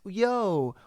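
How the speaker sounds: ordinary delivery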